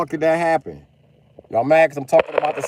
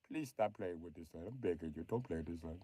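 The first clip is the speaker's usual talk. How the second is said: much softer, low begging; soft, lowered mock begging; quiet plea; 9 semitones lower, 22 dB quieter than usual. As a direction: drop to a hushed, humble plea. Begging the Sun Dodgers.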